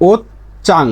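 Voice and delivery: ওত চাং in two parts, ch instead of j; changed consonant. Shown as a demonstration. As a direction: pause after ওত, then hard ch in চাং. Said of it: "এর উচ্চারণটা কি?". (ওত চাং, ভেঙে চ দিয়ে)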